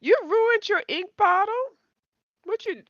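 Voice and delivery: high, loud shocked question; surprised. Acted. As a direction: gasp it out in disbelief. Reacting to a ruined ink bottle.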